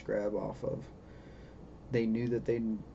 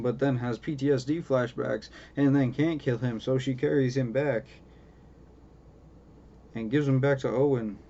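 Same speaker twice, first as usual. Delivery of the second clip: usual speaking voice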